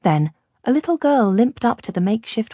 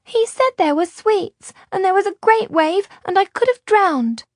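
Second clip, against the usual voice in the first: put-on a small girl grumbling that she was tricked; complaining little girl; 13 semitones higher, 5 dB louder than usual. A narrator's reading. Girl to Luca, complaining of the lie.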